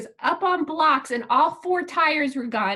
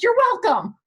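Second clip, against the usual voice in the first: higher, louder sarcastic quip; sarcastic reply; 7 semitones higher, 5 dB louder than usual. Retold or imitated. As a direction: toss it off sarcastically. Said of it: "I'm like".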